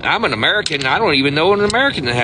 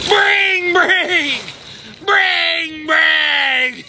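phone ringing imitation; 13 semitones higher, much slower than usual. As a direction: chant it like a desperate ringing phone. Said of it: "They sound desperate".

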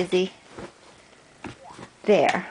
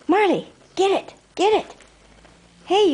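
urging the dog; 10 semitones higher than usual. Real, not acted.

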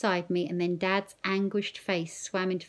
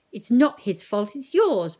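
loud angry outburst at the doctor; mother rounding loudly on the doctor; ranting; 4 semitones higher, 8 dB louder than usual. A narrator's reading. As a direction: blame him hard and loud. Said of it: "Mum ranted on Doctor Bryce".

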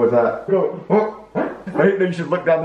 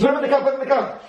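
high, fast, frantic repeat; panicked urging; 10 semitones higher, much faster than usual. Acted. Fish slipping from hands, panicked urging. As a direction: rush it out up high. Acted urgent order in scene.

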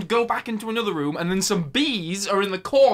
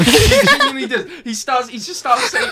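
talking through real laughter, much higher and louder; laughing; 7 semitones higher, 9 dB louder than usual. Real, not acted. Reviewer cracking up over a character, laughing. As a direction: laugh while insisting.

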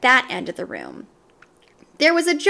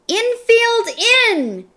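high, loud call to the fielders; shouting; 11 semitones higher, 4 dB louder, slower than usual. A narrator's reading. Damien orders the fielders in, shouting high. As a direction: yell the fielders in close. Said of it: "shouted Damien".

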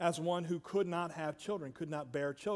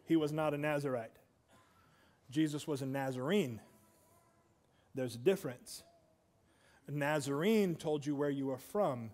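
usual speaking voice

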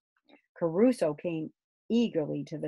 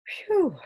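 puff of effort; 11 semitones higher, 4 dB louder than usual. Acted. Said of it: "said Grumble".

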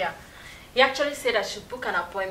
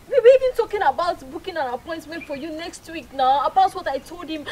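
upset scolding; 6 semitones higher, 8 dB louder than usual. Acted. Wife upset with husband.

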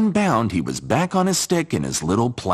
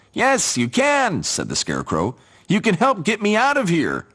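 scarecrow voice; 11 semitones higher than usual. Acted. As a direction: beg to be pulled out. Scarecrow stuck, asks help.

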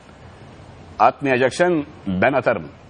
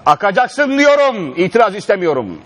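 high, loud coach order; shouting coach; 7 semitones higher, 4 dB louder than usual. Pretend. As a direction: bark the order at the player. Coach barking orders at player.

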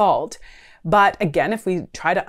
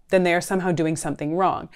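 ordinary talk, nothing marked

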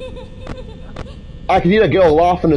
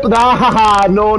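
ordinary delivery

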